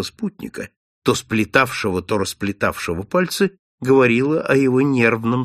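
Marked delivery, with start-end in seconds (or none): none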